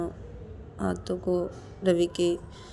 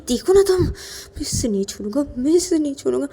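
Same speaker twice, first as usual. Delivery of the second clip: furious rage; 11 semitones higher, 7 dB louder than usual. Acted. Rahul beating the boy, enraged.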